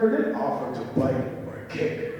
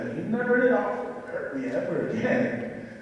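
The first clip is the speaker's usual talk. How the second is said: usual speaking voice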